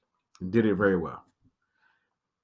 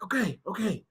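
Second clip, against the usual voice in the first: calming; 9 semitones higher, 6 dB quieter than usual. Retold or imitated. Friend calming himself.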